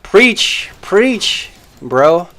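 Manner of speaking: high, loud sarcastic hype; hammed-up preacher's amen; mock cheer. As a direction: cheer him on like a fan, mockingly. Mock cheering on a chat rival.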